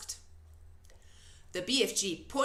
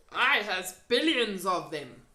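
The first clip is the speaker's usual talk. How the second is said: loud, folksy friendly giant; 6 dB louder than usual. A narrator's reading. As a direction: play the big kind giant.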